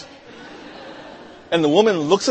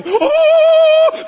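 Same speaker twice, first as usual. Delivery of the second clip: very high, loud sustained oh; hammed-up celestial whoop, held long; heavenly rejoicing; 9 dB louder than usual. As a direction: let out a soaring high cry. Mock heavenly rejoicing at good deed.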